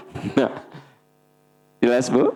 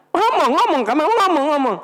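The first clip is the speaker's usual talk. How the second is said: frantic mother. Retold or imitated. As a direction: demand it like a panicked mother. (ibu panik mendesak anaknya bicara)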